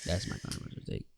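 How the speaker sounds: soft unvoiced whisper; whispered aside. Real, not acted. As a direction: breathe it out as a confession. Closing joke.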